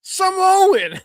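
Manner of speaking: Samoan, yelled high and loud; hammed-up commentator cry of Samoan,; mock announcer yell. Cohost mocking the commentator's loud Samoan call. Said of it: "when he's like".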